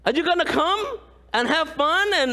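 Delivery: normal talking voice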